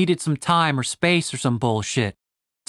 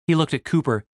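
ordinary delivery

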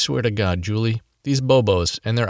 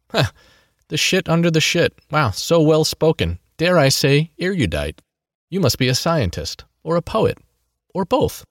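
sarcastic; 7 semitones higher than usual. A narrator's reading. Sarcastic mockery of villain.

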